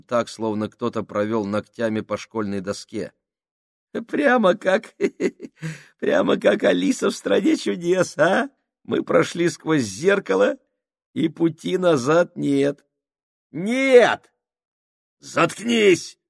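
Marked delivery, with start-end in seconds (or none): 4.1-12.8 сильно выше, хихикая; хихикай как безумец; высокое хихиканье. Acted. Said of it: "захихикал высоким дрожащим голосом". 13.5-14.3 резко выше и громче; выкрикни визгливо; высокий выкрик. Acted. Безумный выкрик Крайчика. 15.2-16.2 выше и громче, отрывисто; рявкни на психа; злой рык. Acted. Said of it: "рявкнул Сакс".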